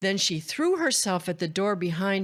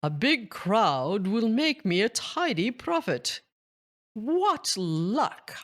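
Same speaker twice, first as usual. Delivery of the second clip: lightly voiced scheming agent, a bit higher; greedy aside; 4 semitones higher than usual. A narrator's reading. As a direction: gloat to yourself. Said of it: "To himself, he added".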